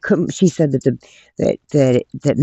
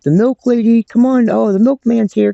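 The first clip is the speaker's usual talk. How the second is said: high, loud mocking taunt; mocking guards; 9 semitones higher, 4 dB louder than usual. Guards jeering at the new inmate, high mocking taunt. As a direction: tease her like a jeering guard. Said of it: "The C Os would be like".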